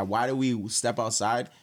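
usual speaking voice

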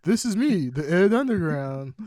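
high, loud mock greeting; Ed Underground impression. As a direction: announce yourself loudly, imitating a friend. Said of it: "he's gonna be like".

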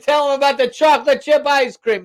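high-pitched mock holler; mock calling out. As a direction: holler for Joe as a pretend staffer. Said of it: "They're like".

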